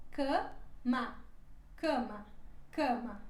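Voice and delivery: cama with a nasal first a and an open final a; careful model word. Shown as a demonstration. Teacher repeats cama for students to copy. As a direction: repeat cama slowly and clearly. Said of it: "Primeiro é mais nasal e depois mais aberta".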